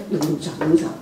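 soft inner voice. Retold or imitated. Retold inner self-talk.

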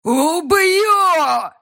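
furious roar, high and loud. A narrator's reading. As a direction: roar a death threat. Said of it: "проревел банкир".

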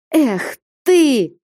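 high exasperated cry; exclaiming. A narrator's reading. Flyonushka scolding Alexei, high exasperated exclamation. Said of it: "вскликнула Флёнушка".

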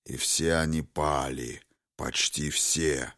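low, hushed dragon lament; грезящий дракон. A narrator's reading. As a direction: let the voice sink softly and slowly. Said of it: "Дракон грезил наяву". (тихо и низко, скорбный голос дракона)

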